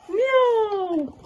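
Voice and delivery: frightened meow. Acted. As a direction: yowl like a scared cat. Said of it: "meow loudly with fright".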